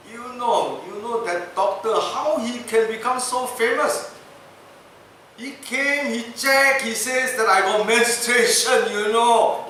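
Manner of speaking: high, loud aggrieved gossip; indignant complaint. Retold or imitated. Governor griping to his friend, high and loud.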